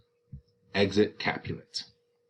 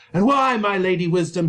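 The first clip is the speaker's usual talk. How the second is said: sneering; 9 semitones higher, 6 dB louder than usual. Acted. Capulet sneers at Nurse.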